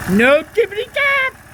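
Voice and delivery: high, thrilled exclamation; excited. Real, not acted. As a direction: celebrate the fixed engine. Engine no longer knocking.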